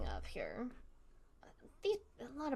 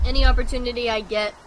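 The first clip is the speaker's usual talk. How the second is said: fast, much louder burst of enthusiasm; excited; 13 dB louder, faster than usual. Real, not acted. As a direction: blurt it out eagerly. Gushing about Lava Hornets.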